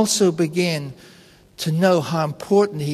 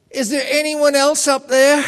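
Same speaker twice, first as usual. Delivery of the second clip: calling up hopefully; 8 semitones higher than usual. Retold or imitated. Stranded man calls up for another rescuer.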